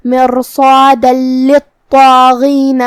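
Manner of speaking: مرصادا للطاغین with the tanween merged into a doubled لام, no nasal hum, slow. Shown as a demonstration. Teacher shows the merging, slow chant. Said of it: "تشدید کے ساتھ یہ حکم کو ادا کریں گے".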